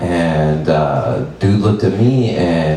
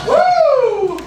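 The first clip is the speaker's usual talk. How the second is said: whoop. Acted. Cheers after a shock joke.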